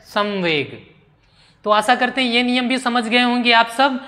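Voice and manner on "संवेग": संवेग said low, the dot sounded as म; nasal as म. Shown as a demonstration. Teacher concluding what we pronounce, संवेग with an m sound. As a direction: say संवेग as 'samveg', an m before व. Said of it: "इसीलिए हम लोग क्या उच्चारित कर रहे हैं".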